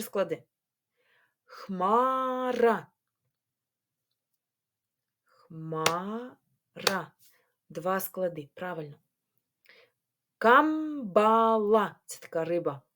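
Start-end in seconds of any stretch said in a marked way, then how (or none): 1.4-7.1 ХМАРА drawn out as хма... ра; syllable by syllable. Shown as a demonstration. Teacher counting syllables with pupils, syllable by syllable. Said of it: "на склади". 10.4-12.0 КАМБАЛА slowly as кам-ба-ла; syllable by syllable. Shown as a demonstration. Teacher dividing a word for pupils, syllable by syllable. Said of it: "на склади".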